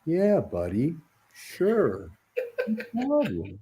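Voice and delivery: laid-back, lightly voiced Sandler; cool calm Sandler. Sandler calmly agreeing to the fan's photo, laid-back. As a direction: shrug it off easy and relaxed. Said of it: "like, cool, calm, collected".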